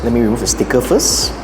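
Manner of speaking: ordinary talk, nothing marked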